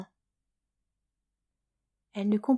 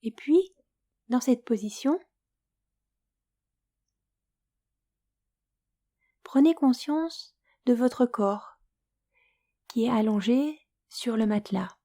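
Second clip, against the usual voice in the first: guided Yoga Nidra; 2 semitones higher than usual. Acted. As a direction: lull the listener toward sleep, unhurried and hushed at times. Leads Yoga Nidra for sleep.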